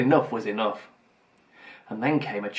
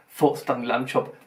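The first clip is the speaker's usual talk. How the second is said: ordinary delivery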